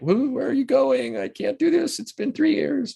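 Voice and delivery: very high, wailing imitation of dismay; hammed-up high-pitched protest at being left; mock dismayed director. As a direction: plead in a high put-on voice.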